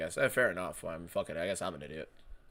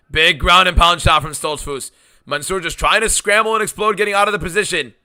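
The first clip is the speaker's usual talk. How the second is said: louder, higher urgent call; excited play-by-play; 4 semitones higher, 8 dB louder than usual. Real, not acted. Live fight play-by-play.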